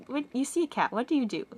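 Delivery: normal talking voice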